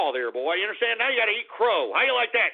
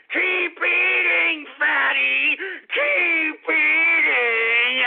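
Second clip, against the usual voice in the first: drawn-out, loud taunt; mocking slow shout; slow jeering shout; 4 semitones higher, 3 dB louder, much slower than usual. Real, not acted. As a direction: stretch the words out and jeer. Taunting liberals, gloating.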